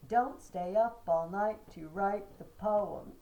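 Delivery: Don't stay up all night to write the poem, slow and loud, beating each strong syllable; mock-solemn slow beat recital; trochaic stress. Shown as a demonstration. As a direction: joke line recited in strict trochees. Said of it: "It would be trochaic".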